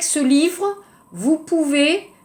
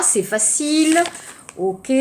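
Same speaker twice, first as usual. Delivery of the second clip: usual speaking voice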